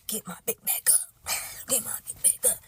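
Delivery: whispered comic chant with a laugh; joking whisper. Pretend. Woman joking about being the bigger person, playful whisper. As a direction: whisper it like a silly secret.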